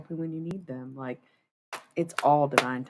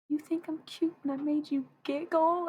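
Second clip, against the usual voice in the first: much higher, delighted self-quote; flattered squeal; 13 semitones higher than usual. Retold or imitated. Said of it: "And I'm like".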